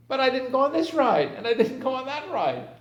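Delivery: put-on high, loud whining children protesting; whiny kids. Kids to father at the park, high loud whining. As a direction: complain like a child who missed the big rides.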